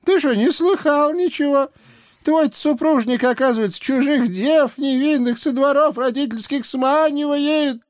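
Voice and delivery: put-on high, loud outraged old hag; indignant old witch. A narrator's reading. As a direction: shout at her in outrage. Baba Yaga voiced by reader.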